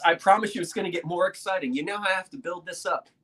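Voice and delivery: ordinary delivery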